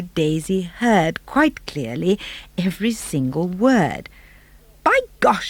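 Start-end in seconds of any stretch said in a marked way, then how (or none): 4.8-5.5 high excited cry; by gosh, high and startled; cried out. Acted. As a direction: exclaim it in shock. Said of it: "she cried".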